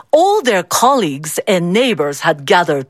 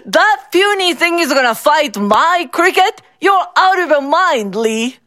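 mocking; 7 semitones higher than usual. Bao mocks the small cricket.

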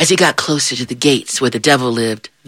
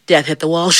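plain reading voice

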